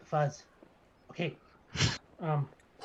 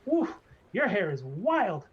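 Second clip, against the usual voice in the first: barber gnome; 4 semitones higher, 6 dB louder than usual. Acted. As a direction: coo over her hair like a gnome barber. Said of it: "he goes".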